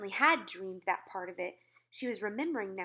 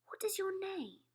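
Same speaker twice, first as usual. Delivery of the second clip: hushed question; 11 semitones higher than usual. A narrator's reading. Queen softly asks.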